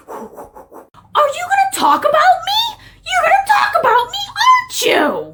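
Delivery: very high, strident put-on voice; shrieking irate woman. Woman accusing officers of talking about her, shrieking. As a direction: squeal it in acted outrage.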